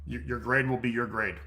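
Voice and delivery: normal talking voice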